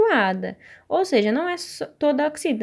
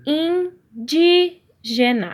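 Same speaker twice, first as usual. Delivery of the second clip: Indígena stretched very slowly, DÍ louder and higher; stressed syllable drawn out; 5 semitones higher, 3 dB louder, much slower than usual. Shown as a demonstration. Teacher showing pupils the strong syllable, slow stress on DÍ in Indígena. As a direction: sound out Indígena syllable by syllable, punching DÍ. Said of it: "é aquela sílaba que é forte".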